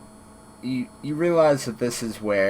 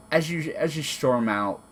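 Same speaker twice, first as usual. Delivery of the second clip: ordinary talk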